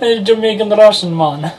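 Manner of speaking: put-on slow mixed Russian-Jamaican accent; a botched Russian accent. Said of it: "when you try to do a Russian accent, you just sound Jamaican".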